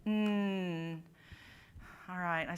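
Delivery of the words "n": N as a hummed, held nasal n; n sound. Shown as a demonstration. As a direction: hum the n through the nose. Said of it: "n sound".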